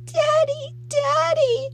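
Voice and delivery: put-on very high, loud child's wail; weeping wail. A narrator's reading. Little girl clinging to her father, wailing. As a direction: wail for a parent. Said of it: "Heather sobbed".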